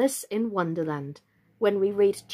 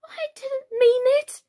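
high, slow, drawn-out plea; pleading; 6 dB louder, slower than usual. A narrator's reading. Alice pleading with the offended mouse. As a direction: plead in a high little girl's voice. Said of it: "pleaded poor Alice".

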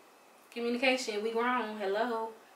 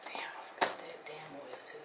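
whispered, softer, to herself; whispered aside; 7 semitones lower, 6 dB quieter than usual. Real, not acted. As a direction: whisper it under your breath.

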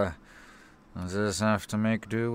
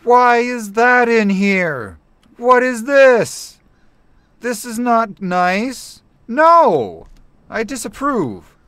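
high, slow, loud disgust; disgusted whining; 11 semitones higher, 9 dB louder than usual. Real, not acted. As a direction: whine in offended disgust. Disgusted at something found.

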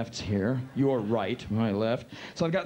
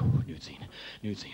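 nervous muttering; 8 semitones lower than usual. Retold or imitated. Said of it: "I got all intense".

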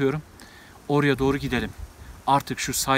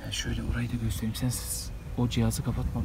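whispering; 3 semitones lower, 10 dB quieter than usual. Real, not acted. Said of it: "Şimdi sessizce burada biraz bekleyeceğiz".

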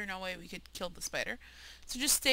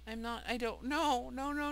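ordinary delivery

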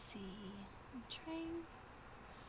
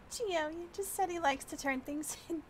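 ordinary talk

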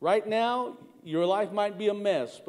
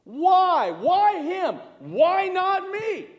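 high, pleading cry of grief; anguished plea, rising and slowing; retold anguished cry; 8 semitones higher, 4 dB louder, much slower than usual. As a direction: cry out to God like a grieving boy. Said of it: "crying hysterically and saying".

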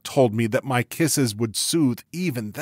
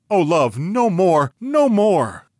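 loud, high, desperate pleading; anguished plea; 9 semitones higher, 11 dB louder, slower than usual. A narrator's reading. Ghost begs her lover to stop, anguished and loud. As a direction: cry out to stop the memory. Ghost recalls burning, breaks off.

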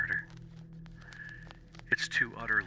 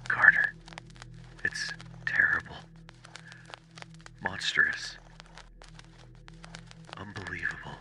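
urgent, frightened whisper; frenzied whisper; 5 dB quieter than usual. Acted. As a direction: whisper in horror at what he sees. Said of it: "Then came the frenzied tones again".

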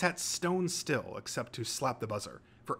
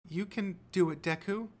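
higher, small shy voice; shy encouragement; 8 semitones higher than usual. A narrator's reading. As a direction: cheer them on bashfully, without volume. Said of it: "she added shyly".

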